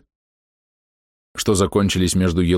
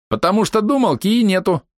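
high taunting quip; mocking jibe; 12 semitones higher than usual. Archer girl jeers at the alchemist. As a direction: needle them. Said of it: "прокомментировали".

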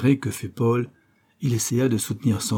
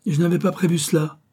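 sudden higher cry of dismay; exclaiming; 8 semitones higher than usual. A narrator's reading. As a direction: blurt it out, caught off guard. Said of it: "s'écria le célèbre policier". (s'écrier, plus aigu)

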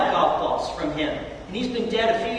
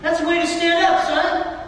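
a proud father's raised, glowing praise; proud dad praising; 6 semitones higher than usual. Retold or imitated. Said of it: "I'm a proud dad. I'm like".

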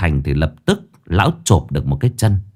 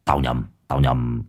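low "heh heh", then softer words; amused, low, quiet old master; sheepish chuckle; 8 semitones lower, 6 dB quieter than usual. Acted. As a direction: laugh off your mistake. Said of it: "Lão cười hè hè".

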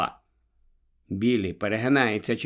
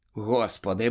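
usual speaking voice

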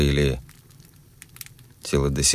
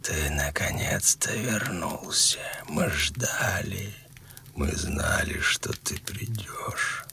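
put-on dying old man's whisper; very old faint voice; 4 semitones higher, 10 dB quieter, slower than usual. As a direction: breathe it out like a dying old man. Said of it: "будто шёпот умирающего старика".

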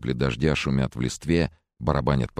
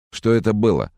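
slightly thick voice, pitched up; a hint of a viscous voice; thick sluggish voice; 7 semitones higher, 4 dB louder than usual. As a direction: ask it in a thick, higher voice. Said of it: "произнёс вязкий голос".

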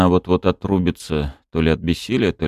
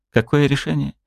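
unenthusiastic; 9 semitones higher than usual. A narrator's reading. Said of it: "без особого энтузиазма спросила она".

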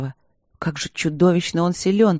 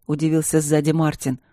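plain reading voice

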